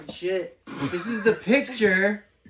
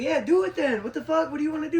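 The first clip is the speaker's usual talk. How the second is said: raised, high-pitched defiant shout; retold angry challenge; 7 semitones higher, 4 dB louder than usual. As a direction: dare them to act, reliving the standoff. Acts out his own challenge.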